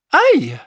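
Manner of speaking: sharp high yelp of pain; pained ouch. Acted. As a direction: cry out suddenly. Flea cries out in pain.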